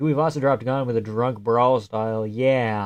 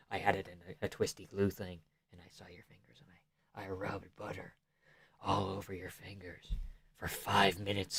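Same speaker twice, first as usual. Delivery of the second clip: mock ASMR whisper, very soft; 5 semitones lower, 18 dB quieter than usual. Streamer tells a creepy butter story in a mock ASMR whisper. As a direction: breathe the words like a creepy ASMR tape. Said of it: "Vamp butter A S M R".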